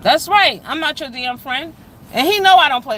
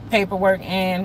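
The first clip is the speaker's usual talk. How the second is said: ordinary talk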